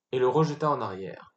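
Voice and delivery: ordinary delivery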